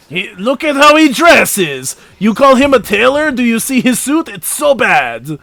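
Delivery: high, scornful fake French accent; mock his clothes as a hammy Frenchman; bad French accent. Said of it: "do a really bad French accent".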